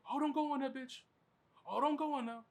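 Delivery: high, loud mock heckle at the screen; shrill-free but high, loud jeering voice; mocking a loud theater talker. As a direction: ham up an obnoxious guy yelling at the movie. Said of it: "just fucking narrating shit".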